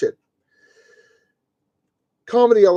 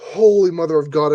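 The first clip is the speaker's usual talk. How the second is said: ordinary talk, nothing marked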